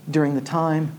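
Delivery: ordinary delivery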